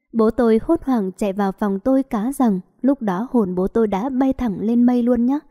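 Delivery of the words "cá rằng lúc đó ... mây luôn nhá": usual speaking voice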